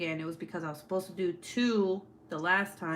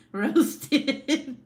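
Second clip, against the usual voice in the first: loud and higher through laughter; laughing; 6 semitones higher, 7 dB louder than usual. Real, not acted. Streamer laughing at a viewer's roast, loud laughing call. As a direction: crow it while cracking up.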